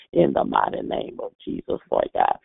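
ordinary talk, nothing marked

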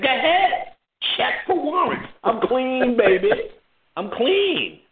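high, smug, taunting; cocky. Retold or imitated. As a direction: dare the cops to check. Cocky to cops.

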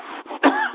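harsh chesty cough; coughing fit. Real, not acted. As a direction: cough hard from pneumonia. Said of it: "start coughing when I talk".

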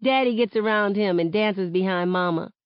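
ordinary delivery

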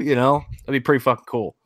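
ordinary talk, nothing marked

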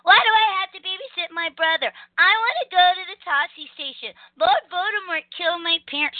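high, whiny hero impression; mock whining. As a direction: whine like a put-upon young hero. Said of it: "always too whiny".

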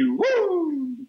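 high drawn-out "Woo!"; Ric Flair "woo". Acted. Podcaster mimics a wrestler's catchphrase, high whooping cry.

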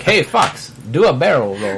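imitating a Japanese accent; light Japanese accent impression; Iwata's Japanese accent. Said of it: "in his Japanese accent".

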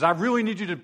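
a God losing his temper, mocked; mock out-of-control God. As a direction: ham up a God about to blow. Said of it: "it's not like God's angry and says".

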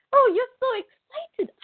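very high, squealing mock excitement; hammed-up happy squeal; Lindsay. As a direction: imitate a delighted caller in a squeaky pitch. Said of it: "Lindsay was so happy".

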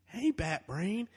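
high, slow sneering Joker; menacing clown drawl; sliding into Joker voice. Pretend. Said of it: "sliding into, like, an actual Joker voice".

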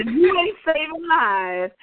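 slow, high mock husband quote; hammed-up husband line; gruff husband. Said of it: "He said".

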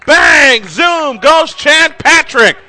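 rising, roaring home run shout; home run call. Real, not acted. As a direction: build from "going" to a full yell. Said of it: "hope you like that home run call".

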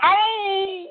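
high crying hollers of a crowd; imitating a hollering crowd. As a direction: whoop like a mob at a fight. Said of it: "Everybody holler".

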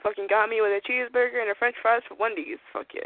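ordinary delivery

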